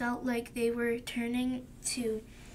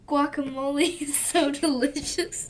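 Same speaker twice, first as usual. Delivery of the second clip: giggling through a louder line; laughing while reading; 3 semitones higher, 8 dB louder than usual. Real, not acted. Child giggles reading Tomato's praise, louder.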